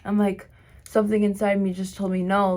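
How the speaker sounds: normal talking voice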